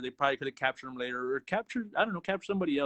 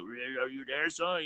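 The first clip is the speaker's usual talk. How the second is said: a hint of a raspy old rat sensei, lower and softer; lightly voiced chain-smoking master; smoker's Splinter voice; 3 semitones lower, 4 dB quieter than usual. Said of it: "smoked, like, a ton of cigarettes".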